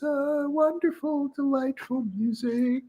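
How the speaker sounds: high, slow, airy send-up of pleasant music; mock delight. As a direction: coo it sarcastically in a high voice. Mocks the soothing music in MRI headphones.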